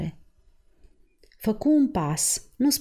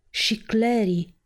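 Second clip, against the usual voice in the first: slow, drawn-out lament; saddened exclamation; 3 semitones higher, slower than usual. A narrator's reading. Father realises another daughter is gone, slow and saddened. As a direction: exclaim it slowly, with restrained grief. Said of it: "exclamă el cu glas întristat".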